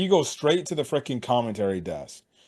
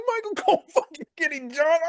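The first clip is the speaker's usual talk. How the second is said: high squeal of disbelief; losing his mind. Retold or imitated. Fan watching the commentator get jumped, freaked-out squeal. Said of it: "I'm losing my mind".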